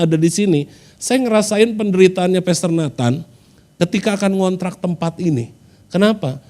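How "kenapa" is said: ordinary talk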